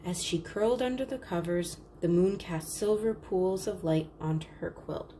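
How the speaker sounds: plain reading voice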